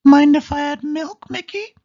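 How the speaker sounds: very high, loud squeaky mouse voice; Minnie voice. A narrator's reading. Minnie asks Mickey about milk, loud high squeak. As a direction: call high across the kitchen. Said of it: "said Minnie".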